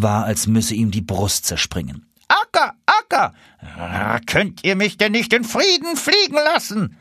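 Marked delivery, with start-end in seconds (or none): 2.3-3.3 high, loud goose call. Acted. Rear geese calling the lead goose, high call. 4.3-7.0 impatient. Acted. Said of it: "tat noch ungeduldiger".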